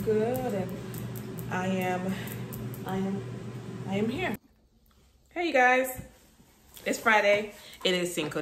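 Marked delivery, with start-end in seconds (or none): none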